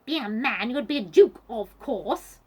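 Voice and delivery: loud, scolding retort; shouting. A narrator's reading. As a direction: shout crossly at the impostor. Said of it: "shouted Vanessa".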